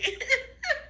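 very high, soft giggle; helpless little high laugh; laughter. Real, not acted. Amused at her own crying, giggling.

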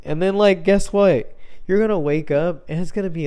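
high, loud call to everyone; rally the listeners, half joking; mock wake-up call. Rousing listeners like an alarm.

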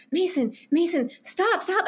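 lightly acted plea to halt; mild urgent plea. Girl to boy while running from guards, mock urgent plea.

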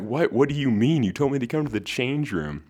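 high, loud, incredulous what do you mean; baffled disbelief. Retold or imitated. Retelling how he answered his friend in the change room, baffled and high-pitched. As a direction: play up the disbelief. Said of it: "And I'm like".

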